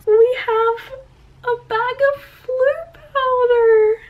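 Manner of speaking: high, loud gushing; high excited squeal. Real, not acted. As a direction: squeal with delight. Gushing over Floo powder.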